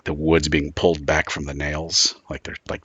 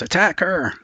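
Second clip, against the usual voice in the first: high, loud cry of warning; shouted alarm; 12 semitones higher, 5 dB louder than usual. Acted. Bell tower guard spots the climber, shouted alarm. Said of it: "and he shouts".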